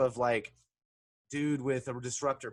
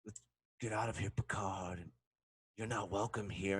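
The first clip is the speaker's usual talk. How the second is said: low, softer acted warning; stern dismissal; 7 semitones lower, 8 dB quieter than usual. Local telling Picard to leave, quietly stern. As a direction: send him away in a subdued, lowered voice. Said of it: "They could have left, like".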